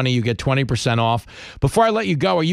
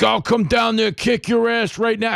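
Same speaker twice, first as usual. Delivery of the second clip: high mock tough-guy threat; 9 semitones higher than usual. Radio host mimicking the border czar, high mock threat. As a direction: playfully puff up a threat, pitched high. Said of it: "And he's like".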